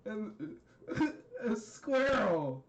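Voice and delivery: high, slow, laugh-choked words; real laughing. Real, not acted. Storyteller still tickled by the squirrel, laughing. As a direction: squeeze the words out through giggles.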